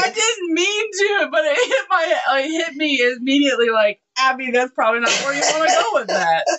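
giggling through the words; high, laughing embarrassed explanation. Real, not acted. Laughing at her own innuendo.

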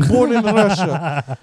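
loud, slow mock Russian accent; drawn-out, hammed-up Russian accent; Russian Filipino accent. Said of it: "Russian Filipino".

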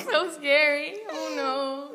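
high-pitched sarcastic dread; mock fear. Student scoffing at losing school points, in mock fear. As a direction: pretend to be terrified, mockingly.

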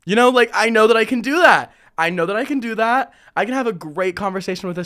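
suddenly loud and high, all confidence; pumped-up self-assurance; confident. Retold or imitated. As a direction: sell it like you're sure of yourself. Self-assured inner voice, raised.

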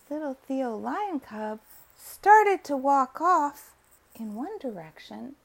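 ordinary delivery, nothing marked

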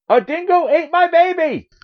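high-pitched wail in a hammed-up movie impression; mock-tragic actress voice; Meryl Streep. Shown as a demonstration. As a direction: cry it out way up high, melodramatically. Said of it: "Meryl Streep in the movie".

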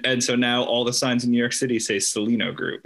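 ordinary delivery

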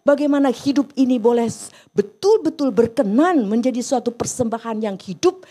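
loud, driving sermon delivery, pressing each line home; fervent pulpit voice, loud and urgent; full-force preaching. Acted. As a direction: preach it out hard to the congregation.

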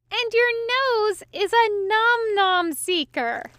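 high, slow, giggling read; laughing. A narrator's reading. Angel reading, laughing.

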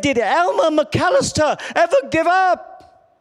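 high yelled question with a laugh in it; slow down and yell the punchline name; coach yelling. Retold or imitated. Coach tricking the huddle, high yelled slow question. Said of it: "He yelled, he yelled".

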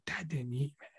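dropped soft, almost under the breath; a son's hushed, heavy aside; quiet quoted son. Retold or imitated. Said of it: "he would wake up and be like".

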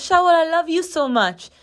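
put-on high little-kid voice; high, childish imitation of a nephew; seven-year-old nephew. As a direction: imitate a small boy declaring love, pitched well up. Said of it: "yes, that's how he talks".

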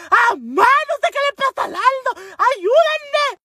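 very high, loud panicked yell; frantic screaming. Retold or imitated. Sister calling mother over Aldo, shrieking like crazy. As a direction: mimic a hysterical scream for help. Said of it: "empezó a gritar como loca".

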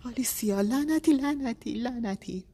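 high, loud cursing that fades; a cry of anguish that peaks and then falls away; anguished outburst. A narrator's reading. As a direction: break down in helpless rage. Husband in anguish, wife silent.

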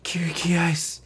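high, strained stage whisper; mock whisper. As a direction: imitate a whispered call for help. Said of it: "we get a whispered".

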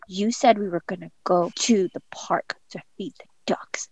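put-on very soft, halting little-kid complaint; disappointed child. Son to mom about the broken promise, disappointed child. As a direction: voice a let-down child, barely above a whisper.

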